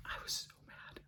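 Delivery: whisper. Real, not acted. Recalling daughter's question.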